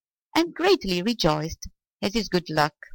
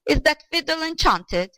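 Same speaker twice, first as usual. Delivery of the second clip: high-pitched, alarmed question; high, startled old man's cry; 10 semitones higher than usual. A narrator's reading. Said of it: "the old man cried".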